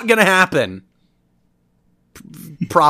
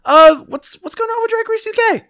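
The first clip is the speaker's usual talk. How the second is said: squeaky high question; 4 dB louder than usual. Acted.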